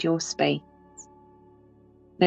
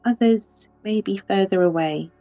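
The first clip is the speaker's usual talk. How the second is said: ordinary talk, nothing marked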